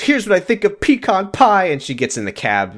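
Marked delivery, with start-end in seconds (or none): 0.0-1.6 a raised, haughty line with pitch lifted; mock-grand kiss-off; mock dramatic exit line. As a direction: toss it off like a diva storming out. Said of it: "And she goes".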